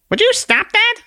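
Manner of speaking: very high, tiny squeaky voice; a tiny exasperated sprite scolding; squeaky little Conscience. Acted. Conscience snaps at the man in a very high squeak.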